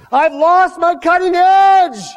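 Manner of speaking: high, loud desperate shout; cry out. Acted. Believer crying out to Jesus in a high, loud desperate shout. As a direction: shout it out as a plea for help. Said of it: "you gotta cry out".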